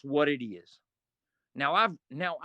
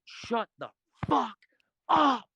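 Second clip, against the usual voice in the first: high, slow, building to a loud up; yelling at Bull to stop, shouting; fed-up yell; 7 semitones higher, 5 dB louder, slower than usual. Retold or imitated. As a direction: let it burst on the last word. Final blowup.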